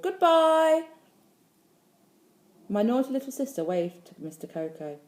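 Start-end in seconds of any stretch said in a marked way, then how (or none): 0.0-0.9 high, loud drawn-out farewell call. A narrator's reading. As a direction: call it down the street. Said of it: "and called".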